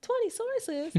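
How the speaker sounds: very high-pitched mock disbelief; incredulous reaction. Overwhelmed student reacting to the source requirement, high incredulous question. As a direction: squeak the demand back in disbelief. Retold shock at paper requirement.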